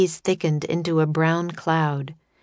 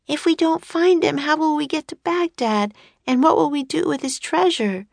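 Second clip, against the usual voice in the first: put-on high-pitched little girl's voice; young girl asking worriedly; 10 semitones higher than usual. Voices Annie questioning Jack.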